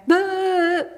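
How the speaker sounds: loud, high garbled holler; imitated wordless blurt. Storyteller imitating Eddie off the bed, wordless blurt. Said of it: "and he went".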